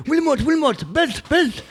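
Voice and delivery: high, mock-excited coach voice; lightly mocking impression of an eager cornerman; imitating Amir. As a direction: squeak out the coach's urging, way up high. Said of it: "And Amir's gone".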